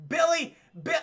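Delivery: half-serious retold yell; mock calling out. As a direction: call after him, then cut off. Arnold calls Billy, gives up.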